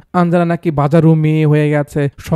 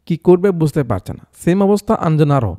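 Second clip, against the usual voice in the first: usual speaking voice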